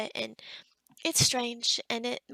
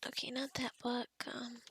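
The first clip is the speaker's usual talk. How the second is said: soft, searching mutter; absent-minded mumbling; 6 dB quieter than usual. Pretend. Healer rejecting a book while hunting a spell, mumbling. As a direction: think aloud quietly, half to yourself. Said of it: "ignore me if I'm, like, mumbling".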